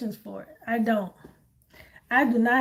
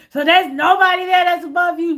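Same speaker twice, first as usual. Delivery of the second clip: loud, high disbelieving shout; outraged shout; 5 semitones higher, 7 dB louder than usual. Retold or imitated. Angry caller demanding someone higher up, shouting. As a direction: yell it like a caller who can't believe it.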